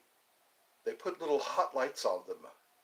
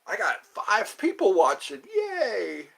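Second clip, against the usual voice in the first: excited cheer; 6 semitones higher, 3 dB louder, slower than usual. Real, not acted. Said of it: "excited at five viewers".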